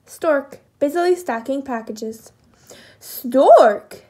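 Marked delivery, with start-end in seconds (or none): 3.0-4.0 loud, falling storybook call; calling out together. A narrator's reading. The three animals hail busy Stork, calling out. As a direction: call the name out as a group. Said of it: "they all said at once".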